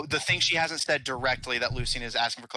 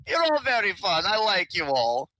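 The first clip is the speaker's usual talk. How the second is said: high, bright delighted voice; gleeful, amused praise; 7 semitones higher, 4 dB louder than usual. Acted. Character delighted after laughing.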